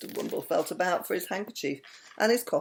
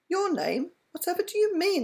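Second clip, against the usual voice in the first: surprised; 13 semitones higher than usual. A narrator's reading. Startled question.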